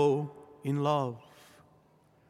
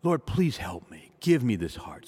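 heartfelt prayer; 6 semitones lower than usual. Acted. Priest leads the church in prayer to the Lord, pleading and earnest. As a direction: beg God humbly, as if kneeling.

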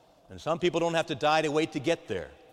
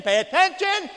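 "pay attention" gridato molto alto e forte; shouted pay attention; 10 dB louder than usual. Acted. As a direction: alza la voce di colpo su "attention". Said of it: "louder and louder".

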